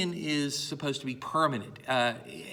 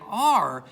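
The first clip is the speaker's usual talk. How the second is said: "are" loud, higher and drawn out; stress on "are"; 6 semitones higher, 8 dB louder than usual. Shown as a demonstration. Teacher explaining the shift in emphasis, stressed "are". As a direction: hold up the word "are" loudly. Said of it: "putting the emphasis on the word states".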